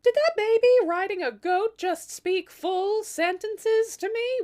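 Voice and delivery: high, loud, slow incredulous; queen shocked that her newborn speaks, loud and high; stunned disbelief. Acted. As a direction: gape at a talking baby.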